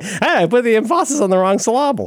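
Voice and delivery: high-pitched grandmother impression. Host quoting what grandmother used to say, high grandma voice. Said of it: "Grandmother used to say that".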